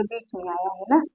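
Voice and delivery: usual speaking voice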